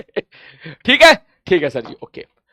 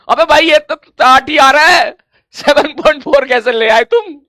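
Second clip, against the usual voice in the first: loud, slow scolding question; exasperated disbelief; 7 semitones higher, 7 dB louder than usual. Real, not acted. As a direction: press them on the wrong answer. Teacher scolding wrong answer. (ज़ोर से, धीरे, झुँझलाई हुई डाँट)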